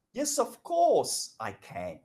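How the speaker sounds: "course" stretched and lifted high, then falling away on "I can"; slow, happy-to-help "Yes, of course" with a big lift on "course"; rising "of course". Shown as a demonstration. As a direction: swoop up on "of course". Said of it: "yes and of course, which goes up".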